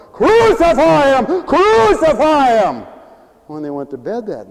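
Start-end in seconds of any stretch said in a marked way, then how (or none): 0.1-2.9 slow, high, loud mock crowd shout; crowd yell. Preacher voices the crowd shouting at Jesus, slow and loud. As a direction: bellow it like the mob. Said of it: "they all yelled and shouted".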